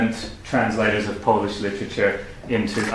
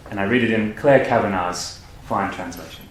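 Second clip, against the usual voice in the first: ordinary talk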